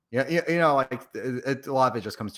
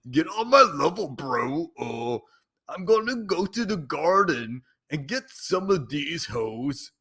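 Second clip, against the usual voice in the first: loud, slow cocky bro rap; alpha bro voice; 7 semitones higher, 4 dB louder, slower than usual. Pretend. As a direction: strut it like a macho bro. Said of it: "now I do the Friends Marathon verse".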